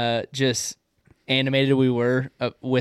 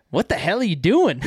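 higher, badly faked Boston accent; terrible Boston accent; 6 semitones higher, 4 dB louder than usual. Co-host mimicking Dave barging into the room, bad Boston accent. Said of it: "That was a terrible Boston accent".

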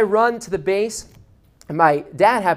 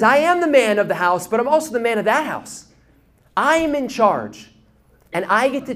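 bold proclamation; 5 semitones higher, 4 dB louder than usual. Retold or imitated. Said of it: "But Jesus says".

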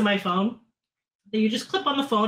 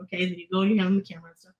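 ordinary delivery

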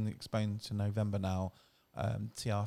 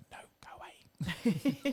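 whisper; 13 semitones higher, much slower than usual. Retold or imitated. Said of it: "So we have to normally go".

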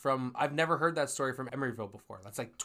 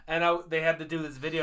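ordinary talk

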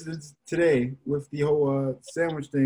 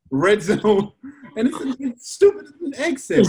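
laughing, pitched up; 7 semitones higher than usual. Real, not acted. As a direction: say it through laughter. Laughing at himself.

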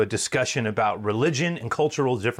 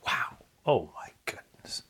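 awed whisper; 4 dB quieter than usual. Real, not acted. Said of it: "awed whisper".

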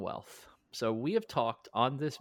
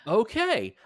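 higher, louder startled okay; bemused acceptance, pitched up; surprised okay; 9 semitones higher, 5 dB louder than usual. Retold or imitated. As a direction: give in, caught off guard by the deal. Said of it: "And I'm like".

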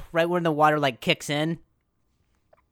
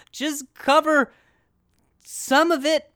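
high slow strained plea; 12 semitones higher, 6 dB louder, slower than usual. Retold or imitated. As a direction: stretch it out high and tense.

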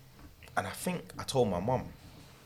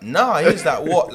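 indignant; 8 semitones higher, 11 dB louder than usual. Retold or imitated. Friend's indignant reaction.

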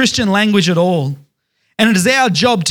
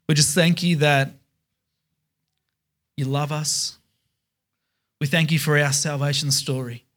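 quiet prayer; 4 dB quieter than usual. Acted. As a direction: pray it gently to close the service. Closing prayer to God.

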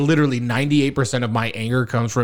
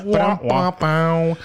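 mock rimshot; 3 semitones higher, slower than usual. Rimshot after his own pun.

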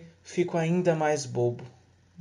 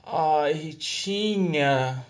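tender lovers; 3 semitones higher, slower than usual. Acted. Lovers whisper sweet nothings.

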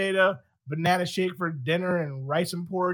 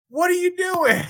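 a much higher, startled parent's question; alarmed parent; 11 semitones higher, 4 dB louder than usual. Retold or imitated. Parent catching the kid running by the camera, pitched-up alarm. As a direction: call it out like a parent who just spotted trouble. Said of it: "and the parent goes".

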